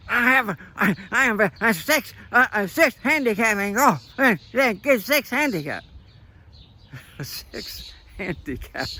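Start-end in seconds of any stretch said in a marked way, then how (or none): 0.1-5.8 high, loud mocking old man; Biden impression. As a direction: shout a boast like a cranky old man. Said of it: "Toxic Joe starts screaming at".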